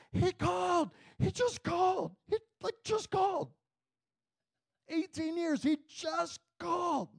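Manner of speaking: very high, overcome squeal; retold high-pitched joyful outcry; weeping praise. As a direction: imitate a woman crying out in joyful disbelief. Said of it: "and she's weeping, and she's, like, praising God".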